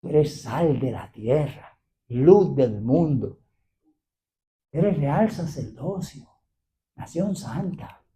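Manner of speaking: hushed, slow and low; solemn declaration. Real, not acted. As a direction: declare it softly, word by slow word. Solemn scripture declaration to congregation.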